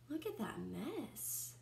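plain reading voice

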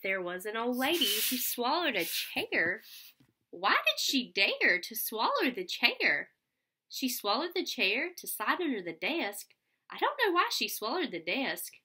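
lively read-aloud storytelling voice; sing-song picture book reading; reading to children. Acted. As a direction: read it out with bounce for little kids.

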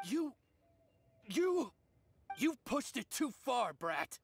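furious stammering bandit; angry stammer. Acted. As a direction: choke on the words in fury. Bandit enraged at being exposed.

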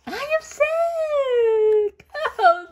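high, loud, slow cry of relief; overjoyed cry. Acted. Gerald hears he is sick.

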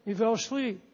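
dropped to a near hush; soft echo. Shown as a demonstration. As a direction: let it sink away quietly. Repeated line, faded softly.

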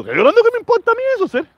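shoots way up high and fast in mock disbelief; high-pitched outraged scoff; indignant retort. Real, not acted. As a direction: scoff at the idea he is crying. Mocking accusation he's crying.

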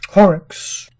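usual speaking voice

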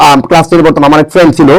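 normal talking voice

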